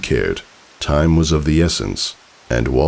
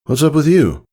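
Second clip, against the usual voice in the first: raised, lightly voiced father, suspicious; a father eyeing his daughter, a bit higher and louder; father asking; 12 semitones higher, 4 dB louder than usual. A narrator's reading. Father asking his daughter, mildly suspicious. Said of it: "he asked".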